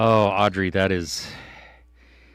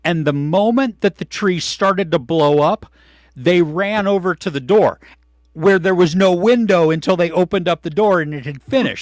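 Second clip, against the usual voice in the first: excited; 8 semitones higher, 6 dB louder than usual. Real, not acted. Excitedly retelling missing the video.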